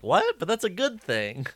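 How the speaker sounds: What shoots up very high, then settles; mock surprise. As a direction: squeal the What in baffled disbelief. Mimicking Sarah's confused reaction.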